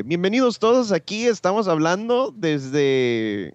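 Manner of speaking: high, slow Spanish TV announcer; TV host voice. Retold or imitated. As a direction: greet the audience like a TV host. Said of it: "Ese es el neutral".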